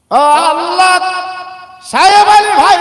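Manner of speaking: loud, high-pitched cry to Allah; exultant cry. Real, not acted. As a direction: shout it out in jubilant praise. Preacher exclaims over big donation. (উচ্চস্বরে উল্লসিত ডাক)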